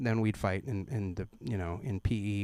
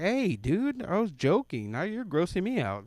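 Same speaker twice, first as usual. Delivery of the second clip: higher, squeaky protest; grossed out; 7 semitones higher than usual. Retold or imitated. He protests to his friend in a higher, grossed-out voice. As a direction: back off, squeaking in disgust.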